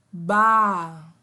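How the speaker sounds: "ba." said slowly and loudly for the class to repeat; a teacher stretching out "ba." clearly and loudly; syllable sounded out. Shown as a demonstration. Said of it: "Prestem atenção no som". (sílaba "ba." pronunciada devagar e bem alto)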